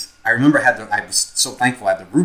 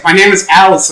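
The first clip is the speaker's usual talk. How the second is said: higher, louder "My name is Alice" with a push on "name"; imitating the kid; 7 semitones higher, 9 dB louder than usual. Retelling the kid rolling over to state his name, raised insistent voice. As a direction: mimic the kid's stubborn raised insistence. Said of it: "he was just like".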